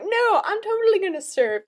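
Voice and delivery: lightly voiced airy surfer friend; carefree friend. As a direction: friend brushing off the shark warning, mock breezy. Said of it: "And she was like".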